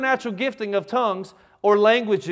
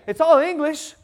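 ordinary delivery

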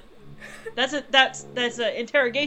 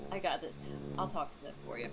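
hushed aside; 8 semitones lower, 15 dB quieter than usual. Acted. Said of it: "Ben's like".

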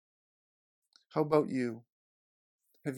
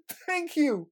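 much higher, louder, beaming thank you; cheerful thanks; 14 semitones higher, 7 dB louder than usual. Retold or imitated. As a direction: thank the officer, delighted. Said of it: "I was actually quite happy".

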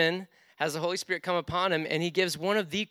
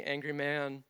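ordinary delivery

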